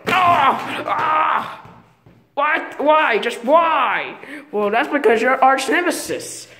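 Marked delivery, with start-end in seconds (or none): 0.1-1.7 mock cry of pain. Character hit in pretend play.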